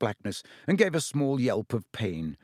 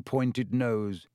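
ordinary delivery

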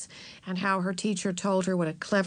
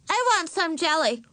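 put-on high demanding small-child voice; demanding little girl; 10 semitones higher, 4 dB louder than usual. A narrator's reading. Little sister cutting in at dinner, demanding. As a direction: pipe up high as a little girl wanting something.

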